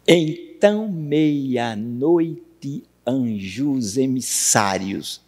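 slow, drawn-out verse scansion; syllable-by-syllable scansion. Shown as a demonstration. Teacher counting a verse's syllables for the class, slow scansion. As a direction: count out "Então, meia-noite, anjos emissários" in separate stretched syllables. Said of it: "versos de quantas sílabas?".

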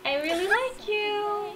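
high, slow, soft lovestruck voice; shy confession. Acted. Voicing her Sim confessing to Kiyoshi, shy and high. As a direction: coo it like a shy teen.

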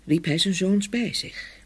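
usual speaking voice

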